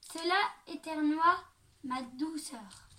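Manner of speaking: plain reading voice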